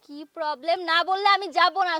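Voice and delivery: wife repeating herself loud and slow. Acted. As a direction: hammer it home, slow and loud. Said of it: "শুনো নাই?".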